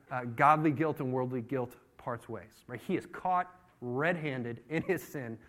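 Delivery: ordinary talk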